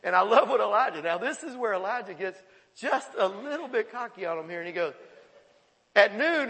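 normal talking voice

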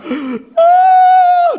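high, loud mock wail; hammed-up tearful "oh"; mock crying. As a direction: cry out as if overwhelmed. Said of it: "they're crying because they get".

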